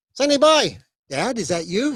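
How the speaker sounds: put-on high, loud old father's voice calling out; a ghostly dad greeting his son; dead dad as moth. Dad calls to his son, high and loud.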